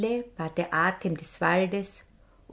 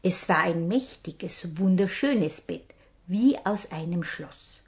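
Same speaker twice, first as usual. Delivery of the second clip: ordinary delivery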